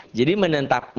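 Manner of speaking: ordinary delivery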